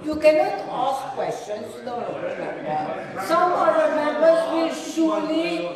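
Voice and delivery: stern, loud rebuke over the chamber. Real, not acted. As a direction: cut through the noise firmly. Speaker scolds member over the noise.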